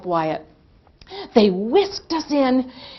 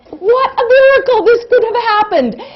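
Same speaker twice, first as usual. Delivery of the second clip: much higher and louder relief; overjoyed; 11 semitones higher, 8 dB louder than usual. Retold or imitated. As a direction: burst out in delighted amazement. Relieved exclamation after the fix.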